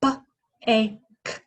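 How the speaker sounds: B, a, k said as separate sounds; each phoneme apart; segmenting sounds. Shown as a demonstration. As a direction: sound out bake one by one. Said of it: "Also three".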